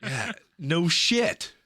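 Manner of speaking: high-pitched mock-indignant retort; exasperated comeback. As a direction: throw the insult right back, hammed up. Retold exasperated comeback to the friend.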